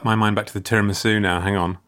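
ordinary talk

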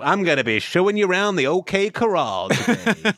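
hammed-up Wild West museum host; cowboy reenactor. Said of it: "a person who's going".

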